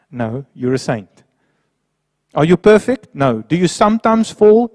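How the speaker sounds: usual speaking voice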